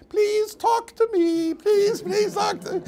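high, drawn-out mock begging; pleading. Husband following his silent wife around the house, mock pleading. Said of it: "I used to follow her around the house".

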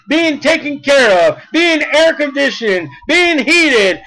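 ordinary talk

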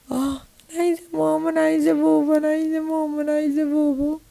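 high, repetitive tearful whine; whining for parents. Acted. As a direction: whine on and on like a small child. Little girl crying for parents. (زن طفلة عايزة أهلها)

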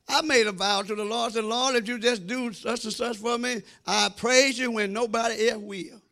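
ordinary delivery

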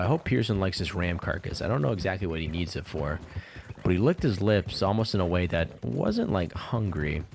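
ordinary talk, nothing marked

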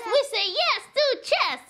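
high, fast chant; excited. Acted. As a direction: chant the slogan cheerfully.

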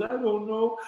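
high-pitched, imitating a scared wife; retold fear. Frightened wife answering her husband, retold fear. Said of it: "I'm scared".

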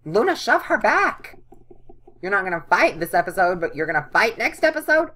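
loud, high-pitched disbelief; exasperated yelling. Real, not acted. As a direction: yell at the game character in indignant protest. Yells at game character in disbelief.

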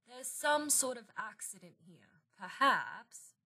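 put-on affected English accent, softly delivered; fake English accent. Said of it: "in a fake English accent".

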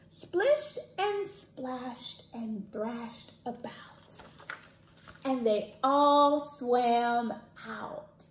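slow. Acted. Slowed story ending.

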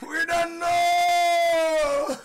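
very slow loud shout, know held long; drawn-out preached refrain. Acted. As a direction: stretch every word out.

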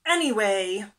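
loud, dismissive brag; boastful. A narrator's reading. Turtle showing off to friends, loud boast. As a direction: brush it off loudly. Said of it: "boasted Turtle".

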